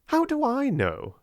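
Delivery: snapped. A narrator's reading. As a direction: bite the answer off impatiently. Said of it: "snapped Lavinia".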